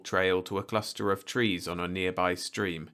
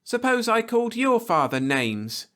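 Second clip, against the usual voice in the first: much higher, louder challenge; demanding; 6 dB louder than usual. A narrator's reading. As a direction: challenge him belligerently. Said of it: "she demanded".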